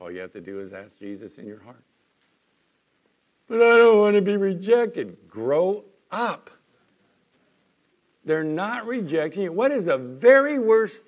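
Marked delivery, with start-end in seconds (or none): 3.5-5.3 much higher and louder mock whine; whining objection. Preacher mimicking a fearful churchgoer, high and loud whine. 5.3-6.5 blunt rebuke. Real, not acted. Preacher rebukes the objection.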